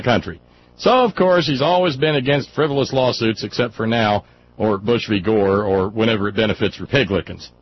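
emphatic read-aloud voice, punched openings; declaiming a written complaint; dramatic email reading. Acted. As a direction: read it out like a proclamation. Reads listener's email aloud.

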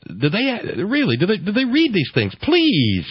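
high, incredulous outburst. Real, not acted. Host scoffs at the headline he read, incredulous. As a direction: scoff in high-pitched disbelief.

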